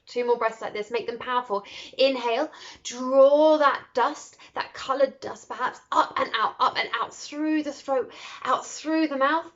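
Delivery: driving breathwork guide; energised breathwork cue. Acted. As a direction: push them to make it powerful. Leads a breathing meditation.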